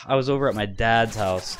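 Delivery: ordinary talk, nothing marked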